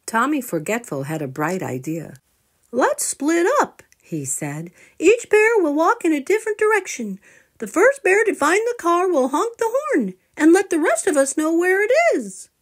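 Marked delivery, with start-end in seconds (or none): 2.7-3.8 eager little bear. Acted. Tommy's bright idea. 5.0-12.5 put-on high, lively child voice; eager little bear. Tommy Forgetful explaining his plan to family, high and eager.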